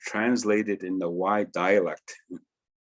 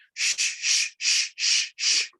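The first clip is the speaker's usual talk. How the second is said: ice crystallizing shh; slower than usual. Retold or imitated. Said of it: "it made this sound".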